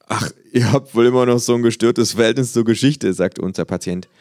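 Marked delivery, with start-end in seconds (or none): 0.1-3.1 loud, brash football fan; fan's retort. A narrator's reading. Fan shouting back at the kangaroo, loud and brash.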